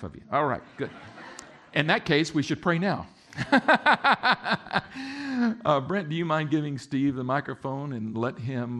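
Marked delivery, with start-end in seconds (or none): 3.3-5.6 high, loud burst of laughter; hearty laugh. Real, not acted. Preacher joking with his congregation, hearty laugh. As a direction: laugh out in quick bursts, then a long tail.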